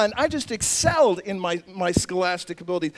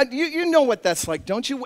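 ordinary talk, nothing marked

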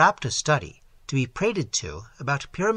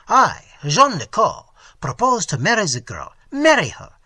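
proud declaration; 5 semitones higher, 8 dB louder than usual. Acted. Nicot proclaims his intention.

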